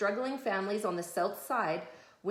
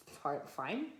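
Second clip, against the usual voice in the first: usual speaking voice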